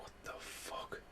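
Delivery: breathy stunned whisper; whispered disbelief. Retold or imitated. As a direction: whisper it under your breath, baffled. Baffled as monster turns to smog.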